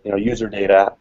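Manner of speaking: ordinary delivery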